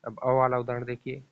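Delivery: ordinary delivery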